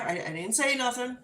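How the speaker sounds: ordinary delivery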